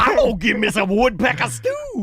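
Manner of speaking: high, loud cartoon character voice; vintage cartoon voice. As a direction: squawk it like an old cartoon. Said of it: "it's, like, talking like a cartoon".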